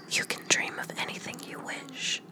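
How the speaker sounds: ordinary delivery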